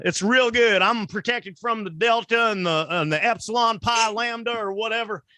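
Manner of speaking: put-on high, twangy good-old-boy voice; Southern yokel. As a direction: brag like a proud country bumpkin. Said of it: "still bragging about it at crab eating parties".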